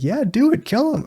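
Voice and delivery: a high, gleeful little instigator; eager high instigating voice. Retold or imitated. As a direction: egg him on in a high kid voice. Voicing eager Oliver.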